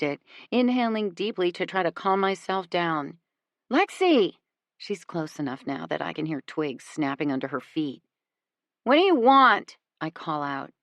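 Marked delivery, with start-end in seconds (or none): 3.7-4.4 higher pitched call into the woods. A narrator's reading. Alexis closing in, calling for Lexi, calling out. 8.8-9.8 tough-guy call, higher and louder; James Dean impression. A narrator's reading. Lexi calls out to Alexis hiding fear, lightly voiced James Dean. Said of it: "doing my best James Dean impression to not seem scared".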